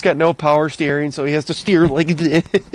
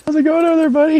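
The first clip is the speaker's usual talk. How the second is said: teasing call; 10 semitones higher, much faster than usual. Retold or imitated. Teasing friend stuck on tractor.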